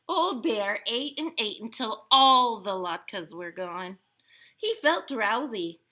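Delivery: usual speaking voice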